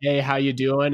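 ordinary talk, nothing marked

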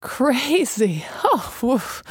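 laughing. A narrator's reading. Messenger laughing at being called crazy. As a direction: laugh until she gasps. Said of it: "Messenger gasped".

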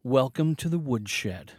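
slow show greeting. Shown as a demonstration. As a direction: say it slowly with the listener. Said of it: "Say it with me".

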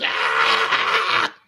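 high, slow whiny wail mocking a tantrum; hammed-up crybaby tantrum voice; mock temper tantrum. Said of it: "you do a little temper tantrum".

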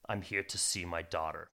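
a dark figure speaking low and cold; cold hooded stranger. Acted. Hooded figure answers mother.

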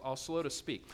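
ordinary talk, nothing marked

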